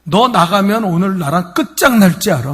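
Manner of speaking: loud, high threats; furious. Retold or imitated. 나가려는 남편에게 분노해 협박하는 아내. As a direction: threaten the husband with everything. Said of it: "막 욕을 하는".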